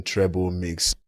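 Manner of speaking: normal talking voice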